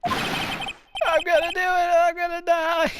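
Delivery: high, slow, drawn-out wail; hammed-up high despairing whine; whiny Larry wail. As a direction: wail it like a melodramatic wreck. Larry wailing about dying.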